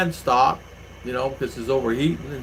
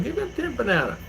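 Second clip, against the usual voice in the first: retold instruction; 11 semitones higher, 4 dB quieter than usual. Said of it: "So I could lay".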